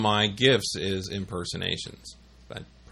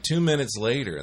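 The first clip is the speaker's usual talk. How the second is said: ordinary talk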